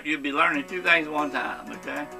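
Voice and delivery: usual speaking voice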